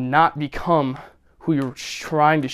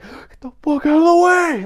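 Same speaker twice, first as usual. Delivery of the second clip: mock angry shout, high and loud; imitated high-pitched angry yell; Tobey Maguire; 13 semitones higher, 8 dB louder than usual. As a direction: yell it slowly, imitating an irate outburst. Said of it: "that video where he's like".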